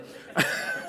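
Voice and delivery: a sudden squeal of laughter at his own joke; high burst of laughter. Real, not acted. Preacher laughing at his own joke about Leslie, high laugh.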